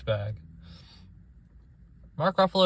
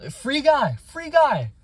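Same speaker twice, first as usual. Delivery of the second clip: much higher, louder exasperated cry; exasperated outburst; 12 semitones higher, 7 dB louder, slower than usual. Real, not acted. Ranting about Ryan Reynolds movies, exasperated outburst. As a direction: throw your hands up at another example. Exasperated naming another example.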